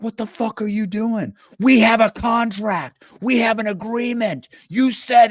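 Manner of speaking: high-pitched, squealing outraged gamer; mock outrage. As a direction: play the betrayed player yelling about the broken deal. Angry Risk player betrayed over Australia.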